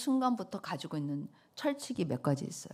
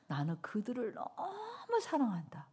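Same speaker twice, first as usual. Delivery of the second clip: tearful longing; 5 semitones higher, 5 dB quieter than usual. Acted.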